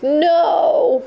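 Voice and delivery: a high, wrenching no; anguished cry. A narrator's reading. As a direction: cry out as the memory hits. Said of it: "her cry rose".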